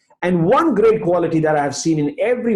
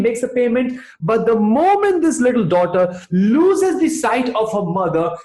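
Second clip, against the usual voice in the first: normal talking voice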